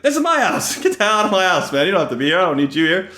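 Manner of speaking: high indignant outburst with a laugh; host throwing a rude guest out, high and indignant; mock indignant yell. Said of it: "It's like".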